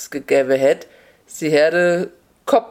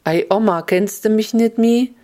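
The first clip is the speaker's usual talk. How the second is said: higher-pitched, put-on concerned question; feigned concern; 6 semitones higher than usual. A narrator's reading. Fox posing as grandchild to fool Oma, feigned concern. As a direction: play the worried grandchild. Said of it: "Der Fuchs tät so".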